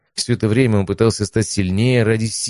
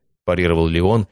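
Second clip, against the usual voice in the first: ordinary delivery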